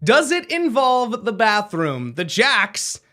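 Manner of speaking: slow build to a high reveal; suspenseful quiz host. Acted. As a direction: repeat the guess slowly, then announce it triumphantly. Host builds up to winning answer.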